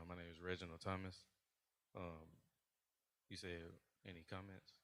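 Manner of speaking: barely audible, mumbled answer; too quiet. Real, not acted. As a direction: speaks too softly to be heard. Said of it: "Can you speak up, please?".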